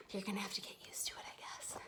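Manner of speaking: whispered aside; whispering. Real, not acted. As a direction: drop to a whisper. Muttered aside while fixing tripod.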